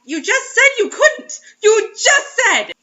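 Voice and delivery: high loud slow outraged complaint; indignant protest. Real, not acted. Reader protesting the lover's contradiction.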